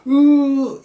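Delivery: high loud strained "으"; straining grunt. Shown as a demonstration. Dad showing how to strain, loud effort grunt. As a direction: strain like pushing with all your might. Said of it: "이런 방법이죠".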